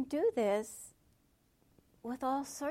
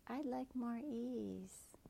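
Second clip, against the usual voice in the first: soft little voice; 6 dB quieter, much slower than usual. Shown as a demonstration. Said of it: "little voice that says".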